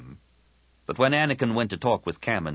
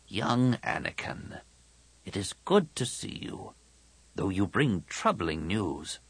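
put-on old Jedi master, grave; 4 dB quieter than usual. Old master greets young Anakin, solemn and kind.

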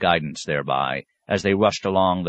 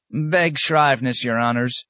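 put-on bow and scrape in a high old crone's voice; old tomato woman; 7 semitones higher than usual. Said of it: "said Missus Grayles".

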